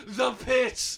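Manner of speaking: high-pitched imitated yell; mock battle cry. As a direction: cry it out as if charging away. Imitating man shouting as he runs.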